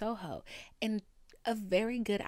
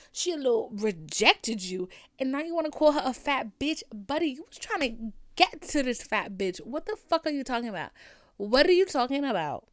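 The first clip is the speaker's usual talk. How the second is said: loud, higher-pitched retold tirade; incredulous angry retort; 4 semitones higher, 7 dB louder than usual. Telling off the friend who insulted her, loud and incredulous. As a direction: hammer the questions at him in disbelief.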